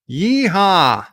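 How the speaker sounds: whooped cheer. Pretend. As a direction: let out a celebratory yeehaw. Cheering a new donation.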